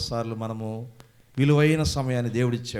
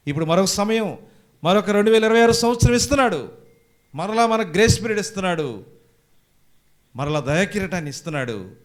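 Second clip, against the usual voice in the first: loud, high proclamation; full-force preaching; 6 semitones higher, 7 dB louder than usual. Real, not acted. Preacher announcing God's new year of grace, loud preaching. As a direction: proclaim it loudly to the congregation.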